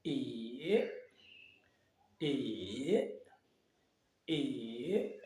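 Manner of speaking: "E" as "ee", dipping low then rising; long, soft falling-then-rising "E"; third tone. Shown as a demonstration. Teacher drills the third tone, "E" dipping and climbing. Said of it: "Le troisième".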